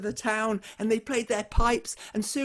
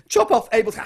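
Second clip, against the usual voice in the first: loud, higher barked order; royal command shout; 5 semitones higher, 10 dB louder, faster than usual. Acted. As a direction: bark the order like a tyrant. King orders execution.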